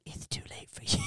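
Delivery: eerie unvoiced whisper. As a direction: whisper it like a doom warning.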